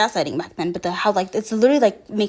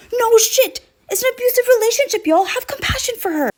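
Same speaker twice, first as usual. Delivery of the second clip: much higher, fast, pleading in an exasperated voice; 12 semitones higher than usual. Real, not acted. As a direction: throw up your hands and plead with the viewers. Exasperated plea to viewers.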